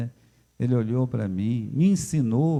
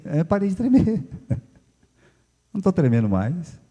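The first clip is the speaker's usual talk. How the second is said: ordinary talk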